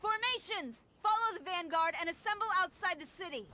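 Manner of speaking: a general calling her soldiers to form up; commanding shout. Acted. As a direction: bark the orders across the courtyard. General orders troops to assemble.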